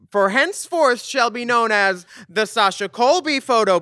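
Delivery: high formal herald voice; town crier. Pretend. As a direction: proclaim it to the whole court. Proclamation continues.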